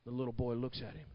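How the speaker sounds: low, very soft storytelling; hushed story narration. Acted. Preacher telling how Daddy pulls out the rock, low and hushed. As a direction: bring it right down for the quiet reveal.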